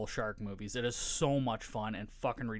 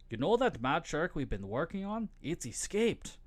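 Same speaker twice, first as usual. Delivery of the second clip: higher-pitched, hammy put-on Swedish accent; attempted Swedish accent; 5 semitones higher than usual. Movie scientist telling a colleague of the escaped shark, attempted Swedish accent. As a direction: do a rough mock-Swedish scientist. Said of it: "I probably can't do a Swedish accent".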